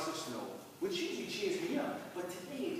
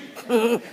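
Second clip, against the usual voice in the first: put-on drunk laugh; 5 semitones higher, 13 dB louder than usual. Drunk uncle calling out.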